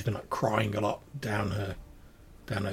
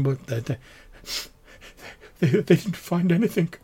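higher, louder, stammering woman's voice; flustered wife close to tears; grateful, tearful village woman; 5 semitones higher, 7 dB louder than usual. Acted. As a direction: stumble anxiously over the words.

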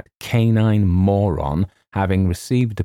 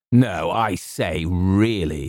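slow, appalled objection; revolted; much slower than usual. A narrator's reading. James rejects being called a hero, revolted. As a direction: recoil with restrained distaste. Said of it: "said James, revolted".

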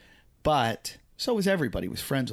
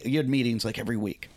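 normal talking voice

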